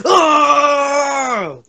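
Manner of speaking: loud, high, anguished shout; real rage yell on dying; raging scream. Real, not acted. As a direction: let out a frustrated scream. Dies in game, screams.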